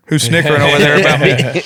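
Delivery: teasing mock indignation; mock accusing. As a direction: pretend to be offended by the snickering. Teasing a snickering friend.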